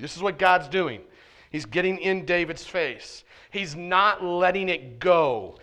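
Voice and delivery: normal talking voice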